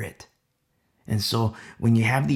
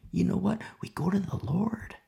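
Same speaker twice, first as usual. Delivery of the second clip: hushed; 6 semitones higher, 6 dB quieter than usual. Real, not acted. Preacher drops to intimate hush.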